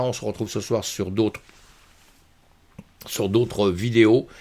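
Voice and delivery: ordinary talk, nothing marked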